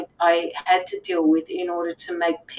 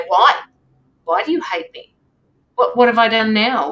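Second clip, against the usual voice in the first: louder, pleading "Why? Why do you hate me?"; hurt defensive outburst; 2 semitones higher, 8 dB louder than usual. Pretend. Triggered person answering a hateful message, indignant and loud. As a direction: fire back as if stung by the message. Imagined hurt reply to hater.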